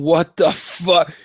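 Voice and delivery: very slow, drawn-out disbelief; retold disbelief. Fan recalling sitting at the B-dubs, slow-motion disbelief. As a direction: stretch it out like a stunned onlooker. Acts out reaction at the bar.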